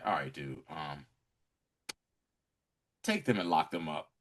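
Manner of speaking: understated imitation of a cool boss; soft and low, unbothered; low, offhand order. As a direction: give the order quietly. Retold offhand order to men.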